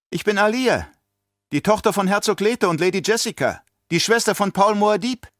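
put-on high childlike voice, proud and clear; a child announcing her lineage; proud little girl. A narrator's reading. Alia introduces herself to the baron, proud girl's voice.

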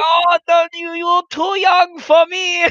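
high, slow, coy falsetto; mock waitress voice. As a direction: tease in the waitress's put-on voice. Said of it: "He's like".

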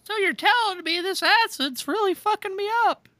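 high stoner voice; goofy high voice. Retold or imitated. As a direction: play the confused patient. Voicing Richard at doctor.

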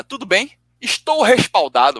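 lightly mocking, high and slow; audacious mimicry. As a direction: imitate his cheek, stretching the last words. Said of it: "olha a audácia do cara".

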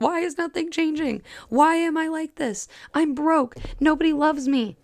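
high-pitched woe-is-me whine; mock self-pity, pitched well up; mock complaining. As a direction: wail it as a self-pitying victim, half-joking. Acting out someone stuck in self-pity.

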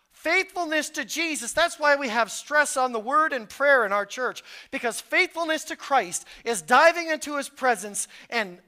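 loud, rising sermon voice pressing the point; full-force preaching. Acted. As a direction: preach it hard to the congregation.

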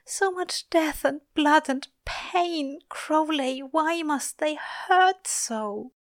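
high, slow, pained voice; anguished lament. A narrator's reading. Angel asking Crowley about the pain, anguished. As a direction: plead in grief, drawing words out. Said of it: "Aziraphale whispers".

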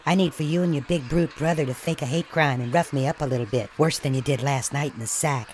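normal talking voice

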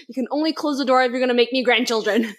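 fast, laughing demanding parent. Pretend. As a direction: bargain for grandchildren, laughing.

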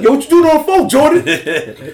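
loud, high-pitched scolding uncle act; Bernie Mac. Retold or imitated. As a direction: imitate an uncle catching the kid out. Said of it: "Bernie Mac got on the phone".

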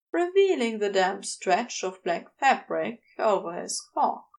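usual speaking voice

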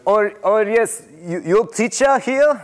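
put-on high, old European accent, halting; doing the old sub's European accent, halting and questioning. Student teacher imitating the old European sub, European accent. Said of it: "That's an accent I know".

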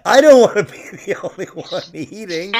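laughing through a mock outraged rant; half-laughing, incredulous; laughing outrage.

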